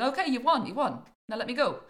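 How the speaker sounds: loud, exasperated surrender; protesting devil. Acted. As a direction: kick up a fuss from inside the bag. Bagged devil protests as he kicks.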